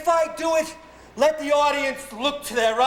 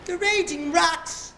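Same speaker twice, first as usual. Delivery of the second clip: high, slow ranting tyrant; Hercules' vein; 6 semitones higher than usual. Acted. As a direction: rant it out like a ham tyrant. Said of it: "this is Hercules' vein".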